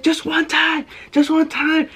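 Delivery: high, pleading mock whimper; pleading kid. Son pleading with mom during the whipping, high whimper. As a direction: beg high and fast like a kid being hit.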